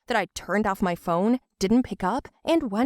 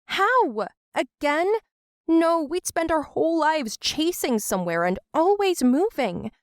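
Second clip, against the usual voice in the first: upset protest; 7 semitones higher than usual. Acted. Daughter protests moving again.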